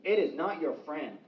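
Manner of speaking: normal talking voice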